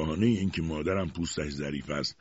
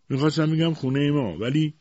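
high, louder, flustered correction; flustered stammer; 9 semitones higher, 5 dB louder than usual. A narrator's reading. Mother backing down before her husband, flustered stammer. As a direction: backtrack hurriedly, a touch of stammer. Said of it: "با لکنت گفت".

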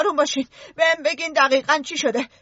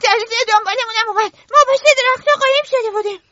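much higher and louder, rushing in fear; panicked; 7 semitones higher, 5 dB louder than usual. Acted. Eric telling how the beast chased them, panicked. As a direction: blurt it out in terror.

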